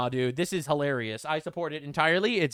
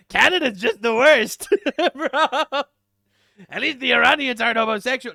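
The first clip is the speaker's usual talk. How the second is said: hammed-up parody of the president; mocking Trump voice; 6 semitones higher, 9 dB louder than usual. Streamer mocking Trump to chat, loud and laughing. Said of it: "and he's like".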